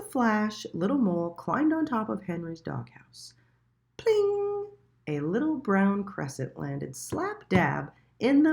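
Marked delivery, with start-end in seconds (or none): 4.0-4.7 high bright pling; a sharp cartoon ping; pling sound. A narrator's reading. Dropping lands.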